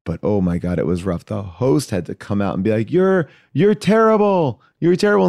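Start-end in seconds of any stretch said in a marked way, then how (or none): 2.9-5.3 mock loud put-down. Show host telling the winner, high loud mock insult.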